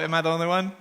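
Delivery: ordinary delivery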